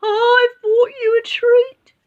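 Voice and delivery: put-on very high, slow quavering old lady; a scared granny coaxing the lion; frightened grandma voice. A narrator's reading. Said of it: "she yelped".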